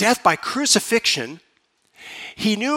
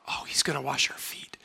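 whispered aside, embarrassed disciple; a disciple whispering in dismay; whispered realisation; 4 semitones lower, 11 dB quieter than usual. Acted. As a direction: whisper it as if the others shouldn't hear.

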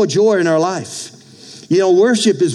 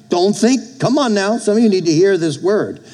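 ordinary talk

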